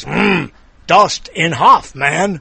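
slow "Dustin Hoffman" broken up like a sentence, after a "Mm"; Tonto. Shown as a demonstration. Host shows how Tonto says it, "Dustin Hoffman" as a slow sentence. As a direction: say "Dustin Hoffman" as separate words, Dust... in... Hoff... man. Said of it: "Tonto would say it like this".

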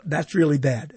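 low, deadpan canned verdict; deep mock radio cart voice. Shown as a demonstration. Radio host replaying his fake cart clip, low and deadpan. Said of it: "It was in that voice".

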